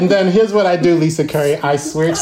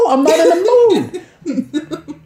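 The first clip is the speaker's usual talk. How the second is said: annoyed retort; 11 semitones higher, slower than usual. Retold or imitated. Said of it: "I was like".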